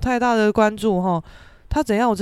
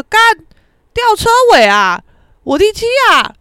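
loud, high exclamation of dismay; outraged disbelief; 11 semitones higher, 11 dB louder than usual. Retold or imitated. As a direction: cry out at the insult. Inner outrage at ranking last.

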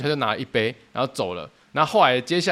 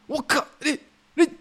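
speechless shock; 13 semitones higher than usual. Retold or imitated. Supervisor speechless at the stall.

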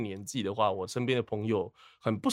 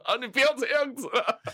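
mock plea; 13 semitones higher, 3 dB louder than usual. Retold plea.

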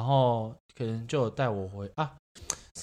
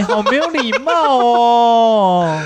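higher, louder indignant squeal; playful outraged rant; mock outrage; 11 semitones higher, 10 dB louder than usual. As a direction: scold the rude relative, hammed up. Outraged at rude relative.

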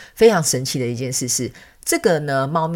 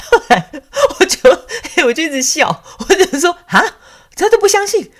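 laughing; 14 semitones higher, 7 dB louder than usual. Real, not acted. Amused narrator laughing as she retells the cat's words. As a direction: still laughing, high and louder.

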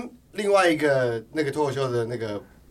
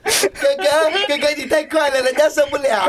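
high, louder mock-coquettish whine; coy girly voice; 12 semitones higher, 7 dB louder than usual. Mock coy reply to joke.

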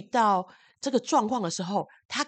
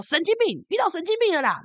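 insulting retort; 11 semitones higher, 6 dB louder than usual. Retold or imitated. The man insults her while fleeing.

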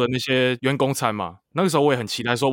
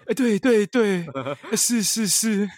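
杨凡 impression; 8 semitones higher, slower than usual. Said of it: "你要学杨凡吧".

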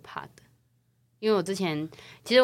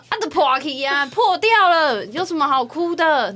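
high, loud scolding of oneself; fierce inner voice; 9 semitones higher, 8 dB louder than usual. Retold or imitated. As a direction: scold yourself for crying over nothing. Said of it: "你的内在很凶".

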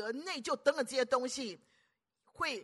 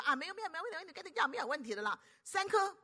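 dismissive doctor; 7 semitones higher than usual. Acted. Doctor brushes off patient's worry.

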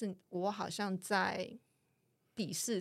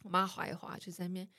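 ordinary talk

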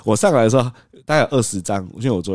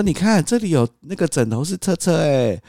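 high, slow playful distraction; distracting sing-song; 9 semitones higher, slower than usual. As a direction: lure the toddler with a playful tone. (高声慢速逗小孩分心)

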